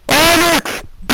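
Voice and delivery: high, loud shout of a name; calling out. Real, not acted. Host calls Alex to take his turn, shouting. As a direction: call out to hurry him along.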